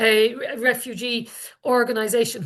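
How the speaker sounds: normal talking voice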